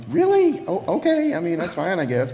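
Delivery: very high, loud incredulous squeak settling down; acted-out bewildered reply; baffled surprise. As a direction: squeal in mock disbelief, then reluctantly accept. Acts own baffled reply to friend.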